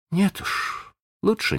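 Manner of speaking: quietly disdainful, understated; contemptuous refusal. A narrator's reading. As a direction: refuse with a touch of scorn. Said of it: "сказала она с презрением". (сдержанно, с лёгким презрением)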